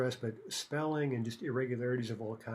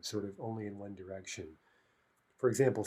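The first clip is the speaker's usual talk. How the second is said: ordinary talk, nothing marked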